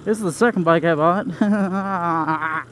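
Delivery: usual speaking voice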